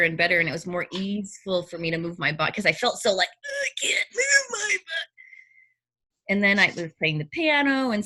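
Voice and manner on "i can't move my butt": mock whine. Quoting herself stuck in her body.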